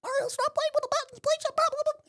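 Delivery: squeaky high mock nagging; mocking partner impression. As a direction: imitate a partner scolding in exaggerated falsetto. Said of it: "Is that what I sound like to you?".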